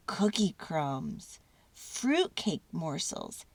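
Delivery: ordinary delivery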